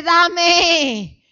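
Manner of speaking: loud, high mock bleat on the word; bleating sheep. Needy little sheep asking the pastor, bleating. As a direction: stretch the ending into a sheep's baa. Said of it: "la ovejita dice".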